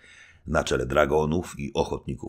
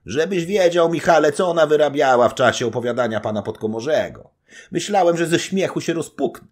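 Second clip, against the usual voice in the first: higher, louder, laughing braggart voice; boisterous old nobleman; 10 semitones higher, 7 dB louder than usual. Acted. Old nobleman telling the knight about his wife, loud and gleeful. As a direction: roar out the gossip with glee.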